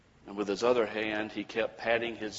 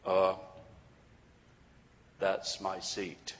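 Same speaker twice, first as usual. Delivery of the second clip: put-on flat, slow, low old man; 3 semitones lower, slower than usual. A narrator's reading. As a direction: state it flatly, slowly. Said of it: "the old man said flatly".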